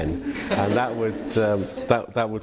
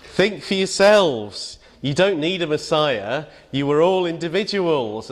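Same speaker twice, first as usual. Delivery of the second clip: Brian exhorting the crowd; 7 semitones higher, 3 dB louder than usual. Retold or imitated. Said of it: "Brian says".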